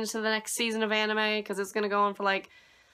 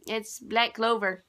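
usual speaking voice

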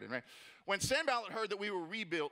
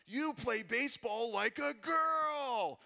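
junior high taunt; 6 semitones higher, much slower than usual. Retold or imitated. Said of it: "king of all junior high trash talk".

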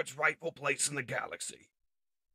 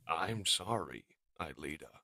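regretful, resigned hero; sorrowful apology; 10 semitones lower, 8 dB quieter than usual. Acted. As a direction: say it deep and softly, trailing away. Hero apologizes before firing.

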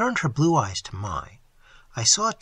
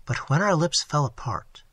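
ordinary delivery, nothing marked

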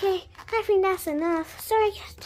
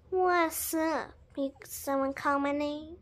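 softer, unbothered answer; low-key reply; 5 semitones lower, 9 dB quieter than usual. Acted. Friend Penguin answers someone calling their name, low-key. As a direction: answer softly as if just roused. Second character answers when called.

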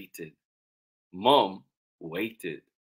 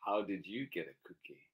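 quick, soft, at normal pitch; subdued question; 12 dB quieter, faster than usual. Acted. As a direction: ask it quietly and quickly. Same question, second reading.

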